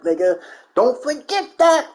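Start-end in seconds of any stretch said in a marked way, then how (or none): none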